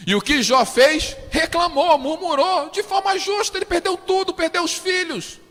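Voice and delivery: high, loud, driving sermon; fiery preaching. Acted. Pastor preaching loudly to the congregation about Job.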